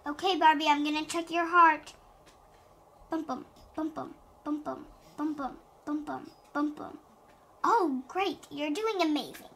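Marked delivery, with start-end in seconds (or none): 3.1-7.0 soft steady bum bum; heartbeat sounds. Acted. Doctor checking Barbie's heart, softer rhythmic bum bum. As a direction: thump it like a heartbeat.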